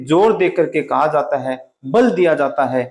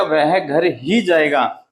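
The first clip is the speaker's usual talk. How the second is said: very slow, ही pressed; stress on घर ही; 2 semitones lower, much slower than usual. Shown as a demonstration. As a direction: insist on "घर ही". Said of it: "वह कहाँ जाएगा?".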